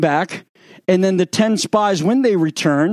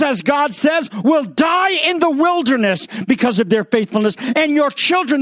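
fiery preaching; 8 semitones higher than usual. Acted. Preaching at full force.

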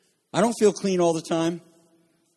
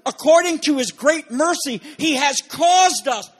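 higher and louder, slowing into a shout; emphatic preaching; 6 semitones higher, 6 dB louder than usual. Real, not acted. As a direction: proclaim it with rising force. Preacher emphasizes scripture climax.

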